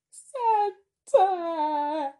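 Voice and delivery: loud, high hammed-up wail; mock crying. Exaggerating grief over a friend leaving, mock crying. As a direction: wail it out in fake sobs, stretching the words. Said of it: "I'm not sure if I'm crying".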